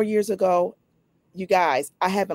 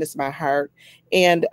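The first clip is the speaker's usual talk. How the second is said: usual speaking voice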